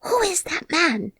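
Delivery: startled, urgent half-whispered question; alarmed loud whisper. Acted. Colin spots a stranger on the wall, high and alarmed. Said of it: "in a loud, suddenly alarmed whisper".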